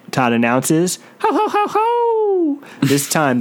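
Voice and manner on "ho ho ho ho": high, slow hooting ho-ho; werewolf howl. A narrator's reading. Narrator voices werewolves howling in the cave. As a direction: hoot like a distant werewolf. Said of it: "the howling".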